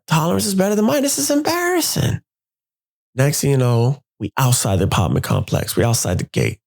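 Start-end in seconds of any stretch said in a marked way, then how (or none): 0.1-2.2 high, slowed, incredulous rant; embarrassed boyfriend at her tolerance; embarrassed disbelief. Retold or imitated. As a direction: lament your own weakness. Embarrassed at drunk girlfriend.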